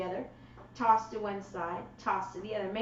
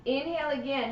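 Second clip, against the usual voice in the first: ordinary delivery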